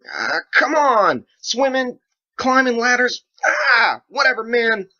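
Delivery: very high, loud, crying rant; anguished shouting, near tears; upset outburst. Acted. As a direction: wail in frustrated despair. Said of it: "became so upset".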